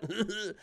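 mock choking splutter; high, spluttering fake choke; imitated choking. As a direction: act out coughing on spicy fumes. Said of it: "coughing and choking".

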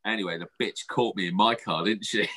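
normal talking voice

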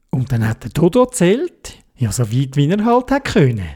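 plain reading voice

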